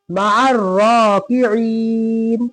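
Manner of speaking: slow, drawn-out melodic recitation; Quran recitation. Shown as a demonstration. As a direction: chant each word slowly and evenly. Recites slowly.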